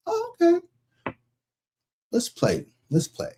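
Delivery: high, sly "let's play"; playful challenge. Acted. Accepting a jealous friend's mind game, slyly. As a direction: tease them into the game.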